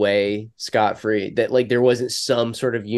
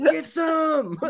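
much higher, hammed-up gunner yell; mock gunner cry; 14 semitones higher, 3 dB quieter than usual. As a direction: squeal it like a gleeful door gunner. Mock soldier battle cry repeated.

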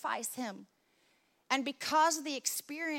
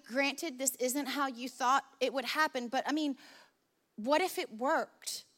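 normal talking voice

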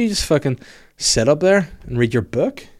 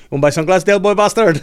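pushy street seller; 7 semitones higher, 7 dB louder than usual. Retold or imitated. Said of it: "He goes".

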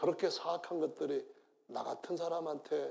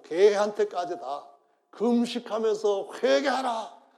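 preaching at full force; 6 semitones higher, 10 dB louder than usual. Acted. Preacher thundering the king's decree to the congregation.

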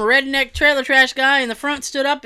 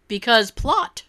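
ordinary delivery

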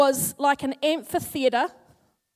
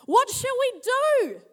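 high, loud, slow panicked cry; servant's high alarmed plea; panicked exclaim; 10 semitones higher, 5 dB louder, slower than usual. Acted. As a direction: voice the frightened servant crying out. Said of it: "The young man exclaimed".

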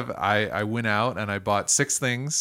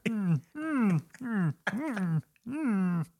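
high hummed frog impression; Kermit the Frog; 10 semitones higher, 5 dB quieter, much slower than usual. Host showing friends the only Kermit he can do, high hummed impression. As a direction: hum it high, like a puppet frog. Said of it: "that's the only thing I can do for Kermit the Frog".

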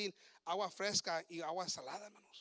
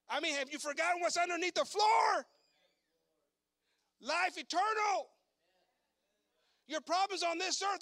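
much higher, much louder shouted preaching; preaching at full force; 10 semitones higher, 8 dB louder than usual. Real, not acted. Preacher rousing the church about eternal life, shouting. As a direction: rouse the congregation with full voice.

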